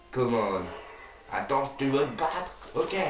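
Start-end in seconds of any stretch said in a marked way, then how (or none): 1.3-3.0 lightly voiced drunk taunt; a hint of a drunk picking a fight; belligerent drunk. Repeats the fight challenge.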